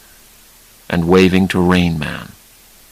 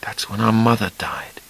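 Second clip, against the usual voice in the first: soft unvoiced whisper; hushed whisper of grief; breathed softly; 2 semitones higher, 9 dB quieter than usual. A narrator's reading. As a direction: breathe it out gently, barely voiced. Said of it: "Charlie breathed softly".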